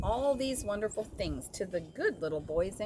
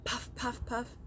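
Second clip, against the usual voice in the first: higher, light puffs; puffing train; 5 semitones higher than usual. A narrator's reading. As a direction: puff it out like steam. Train sound effect opening story.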